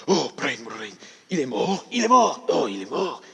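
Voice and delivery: high exclamations of dismay in French; hammed-up French shock; shocked French police. French police hear of the death, shocked. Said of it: "this came as a huge shock to them".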